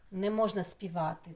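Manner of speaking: normal talking voice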